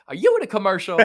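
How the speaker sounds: high, loud clerk voice with a laugh; curious clerk. Retold or imitated. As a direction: play the excited desk clerk. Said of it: "and she goes".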